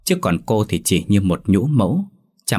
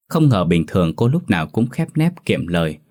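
usual speaking voice

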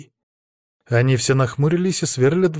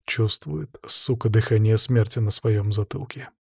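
plain reading voice

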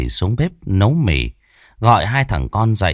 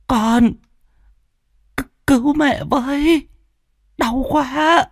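moaning in pain. A narrator's reading. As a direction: groan for help after a fall. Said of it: "Bà già đau đớn rên rỉ".

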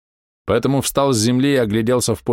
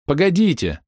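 calling after them; 7 semitones higher than usual. Acted. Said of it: "запоздало крикнула им вслед фея".

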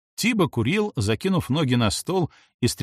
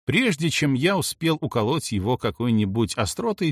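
usual speaking voice

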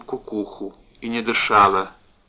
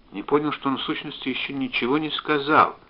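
plain reading voice

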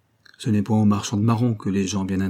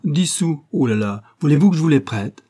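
higher mocking exclamation, a street urchin's jeer; mocking cry; 5 semitones higher, 5 dB louder than usual. A narrator's reading. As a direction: toss it out with cheeky scorn. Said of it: "avec un inexprimable accent". (moquerie gouailleuse, plus aiguë)

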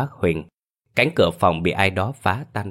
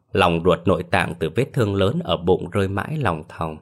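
ordinary delivery

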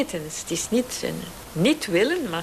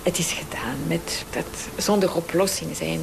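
tender whisper; 3 semitones lower than usual. Retold or imitated. God speaking to the dying mother, tender whisper. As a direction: whisper it like a comforting promise.

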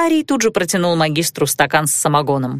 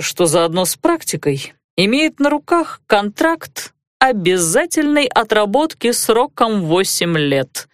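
ordinary delivery, nothing marked

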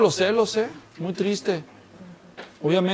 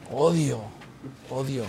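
odio contenido; 5 semitones lower, 9 dB quieter than usual. Acted. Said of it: "Así como diciendo".

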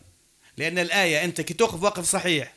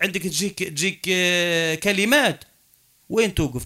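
normal talking voice

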